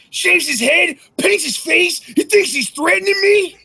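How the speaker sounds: high, loud put-on promo voice; mock taunting recital. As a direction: declaim it like a ridiculous rallying cry. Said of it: "without saying".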